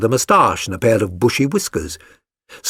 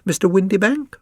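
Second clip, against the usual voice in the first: usual speaking voice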